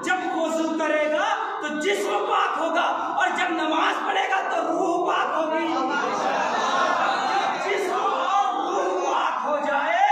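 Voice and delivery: fiery preaching. Acted. Preacher urging the majlis crowd, at full force. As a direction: drive each clause home like a pulpit climax.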